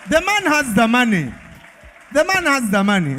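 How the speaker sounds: high, projected hype-man call; excited announcement. Real, not acted. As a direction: proclaim the winner to the whole crowd. MC announces prize to crowd.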